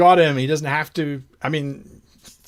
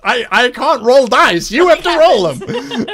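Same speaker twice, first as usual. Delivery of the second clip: high, loud, amused protest; mock exasperation; 7 semitones higher, 7 dB louder than usual. Real, not acted. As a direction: throw up a loud, high-pitched complaint, nearly laughing. GM protests broken mechanics, laughing.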